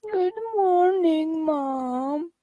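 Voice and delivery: high, slow, drawn-out whine; whining child. Shown as a demonstration. Mother demonstrating her daughter's whiny good morning. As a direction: whine it like a nagging little girl. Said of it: "How'd you whine good morning?".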